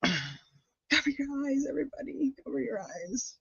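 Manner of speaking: high, soft, slow singsong warning; drawn-out high hushed caution; mock warning. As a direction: tease them to look away. Warning viewers before gross photo.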